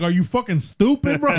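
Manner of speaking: exasperated disbelief. Retold or imitated. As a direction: act out telling off a clueless friend. Retelling an exasperated confrontation.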